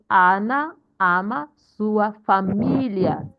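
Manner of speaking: slow reading, long MI in 'família'; stress on I in família. Shown as a demonstration. Teacher reading aloud to show stress, slow with 'família' stressed on MI. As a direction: lean hard on the accented I of 'família'. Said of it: "we are stressing the I in familia".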